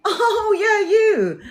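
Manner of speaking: fake recognition. Pretending to recognise her client, high and fake-bright. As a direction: pretend you know her. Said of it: "And I'm like".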